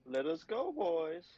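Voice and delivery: high, hyped rallying call; rally the team; excited cheer. Real, not acted. Cheering party on.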